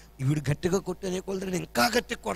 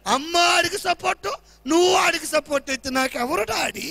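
put-on higher, louder, slow insistent child; loud demanding daughter; 8 semitones higher, 7 dB louder, slower than usual. Daughter loudly demanding daddy punish her brother, higher and slow. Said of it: "కూతురు చాలా గట్టిగా మాట్లాడతారు".

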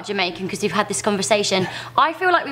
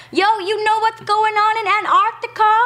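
hammed-up Canadian voice; Canadian accent; 9 semitones higher than usual. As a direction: lay on a thick Canadian accent. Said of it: "you sound like the biggest Canadian".

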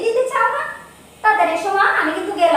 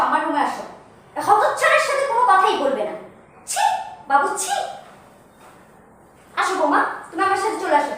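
ordinary talk, nothing marked

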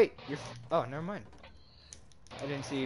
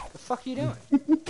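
high, loud mock outrage at a teammate; hammed-up disbelief, pitched way up; incredulous high yell; 12 semitones higher, 7 dB louder than usual. Retelling teammate run to zone, mock shocked yell. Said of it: "I was like".

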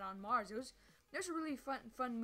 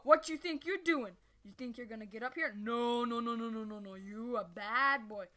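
mock scolding; 7 dB louder than usual. Scolding a mob.

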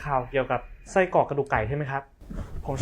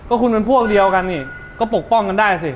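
loud, higher accusing snap; angry retort; 6 semitones higher, 9 dB louder than usual. Acted. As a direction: throw the accusation back hard. โต้เถียงกล่าวหาอย่างโกรธ. (ตอกกลับคนที่ปกป้องป้า ด้วยเสียงโกรธดัง)

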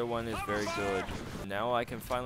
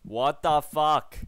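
loud, drawn-out, raised disbelief; exasperated shout; 4 semitones higher, 8 dB louder, much slower than usual. Real, not acted. Player baffled by the game, slow exasperated shout. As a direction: stretch each word in loud exasperation.

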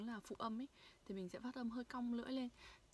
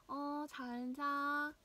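cheerful goodnight; 5 semitones higher, 6 dB louder, slower than usual. Shown as a demonstration. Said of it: "Hãy cùng luyện tập lại nha".

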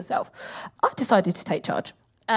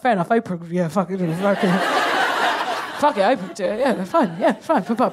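low, muttered giving-in with chuckles; grudging assent; 3 semitones lower than usual. Acted. Comic conceding a star could run, low grudging agreement. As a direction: concede it low, half laughing.

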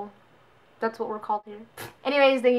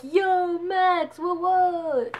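high, hyped-up bro greeting; 7 semitones higher, much slower than usual. Retold or imitated. Friends hyping up Max at the party, high and excited. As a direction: bros cheering their friend Max in.

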